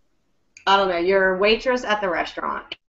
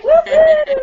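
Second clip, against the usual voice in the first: high, loud party whoops; squealing whoop whoop of joy; celebratory whoop; 6 dB louder than usual. Shown as a demonstration. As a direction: cheer like you just won. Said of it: "jump up and down and celebrate".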